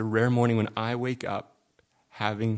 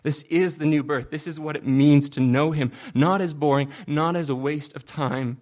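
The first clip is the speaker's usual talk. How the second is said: louder, higher, driving each not; full-force preaching; 3 semitones higher, 5 dB louder than usual. Acted. Pastor building to a climax for the congregation, impassioned. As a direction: preach it with rising fervour.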